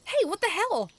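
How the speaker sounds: high, loud indignant cry; Shrimp Lips crying out. A narrator's reading. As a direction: yelp it out in outrage. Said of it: "who cried out".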